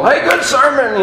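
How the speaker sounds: high, lightly voiced praise from a churchgoer; grateful churchgoer. Churchgoer praising the preacher, lightly mimicked and high. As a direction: toss off the compliment like a pleased parishioner.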